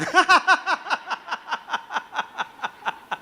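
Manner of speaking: real high burst of laughter. Real, not acted. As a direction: laugh out at own joke. Laughs after joke. (tawa lepas bernada tinggi)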